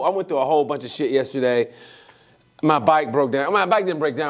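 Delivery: ordinary talk, nothing marked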